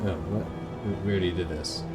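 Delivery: normal talking voice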